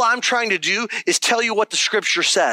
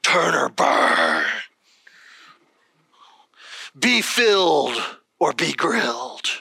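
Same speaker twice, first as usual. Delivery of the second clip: low, slow preacher impression with heavy pauses; mock hellfire preacher, low and slow; fire-and-brimstone pastor; 8 semitones lower, slower than usual. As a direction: draw out each warning like an old-time pastor. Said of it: "there was, like, a pastor. He was like".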